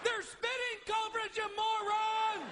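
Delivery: high, exasperated yell; hammed-up outraged scream. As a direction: holler it at the screen, stretching the last words. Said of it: "I am screaming at my television set".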